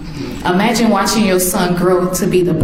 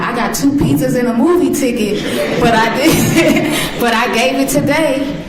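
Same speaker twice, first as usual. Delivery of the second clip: higher voice through laughter; laughing teen; 5 semitones higher than usual. Retold or imitated. Son laughing as he tells his mom about the pizzas. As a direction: giggle through the confession.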